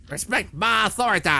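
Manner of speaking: loud, high mock yell; Eric Cartman. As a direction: yell it like an indignant cartoon tyrant. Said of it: "Eric Cartman pops up yelling at you".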